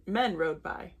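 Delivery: usual speaking voice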